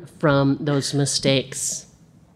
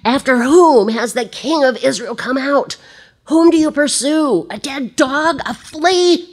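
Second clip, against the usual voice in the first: high, loud indignant outburst; mock dead dog, a flea, in a high indignant voice; fiery David; 9 semitones higher, 4 dB louder than usual. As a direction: fire back at the king, red hair flaring. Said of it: "his red hair flaring up".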